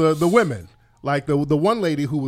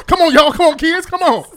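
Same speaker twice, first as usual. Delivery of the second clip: calling out; 10 semitones higher, 5 dB louder than usual. Real, not acted. Calling the kids to hurry.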